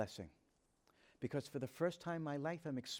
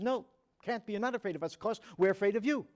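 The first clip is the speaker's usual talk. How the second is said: loud and high, waving the idea away as stupid; incredulous; 6 semitones higher, 8 dB louder than usual. Retold or imitated. Jamal to Hanan, rejecting his fear as absurd, loud and incredulous. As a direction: brush it off, then hit YOU hard. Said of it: "as if I said something very stupid".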